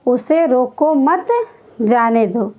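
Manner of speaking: उसे रोको मत as one phrase, pause, then जाने दो; pause after मत. Shown as a demonstration. Teacher reading out the example, pausing after मत. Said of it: "देखिए जी इसका उदाहरण है".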